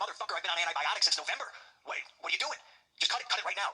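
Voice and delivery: high, exasperated mock rant; retold outburst at the doctor. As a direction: fire it back at him in disbelief. Angry retort to the doctor.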